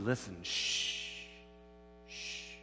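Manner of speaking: slow, low, hushed voice; God hushing. Acted. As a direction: hush them to listen, then speak slowly and softly. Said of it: "And God said".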